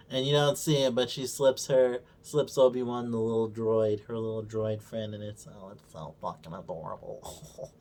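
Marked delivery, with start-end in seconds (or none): 5.5-7.7 cooing. Real, not acted. Gushing over the droid.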